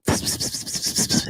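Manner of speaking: unvoiced psst hissed under the breath; mock whisper. Manager gossiping about them across the lobby, whispered psst. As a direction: hiss it like a gossip pointing across the room.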